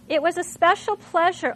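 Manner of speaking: special lifted high and loud; stress on special. Shown as a demonstration. Teacher reading aloud to the class, stress on special. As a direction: land hard on the word special as you read. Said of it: "look at that same word".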